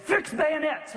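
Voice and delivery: high-pitched battle order, mock yell; yelled command. Commander repeats the order to his stunned men, yelled high. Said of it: "And he yelled it".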